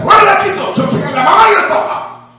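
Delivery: grito agudo y fuerte de autoridad; orden gritada. Retold or imitated. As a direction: echa a todos de la casa con autoridad. Ordering the crowd out. (grito de mando para sacar a la gente)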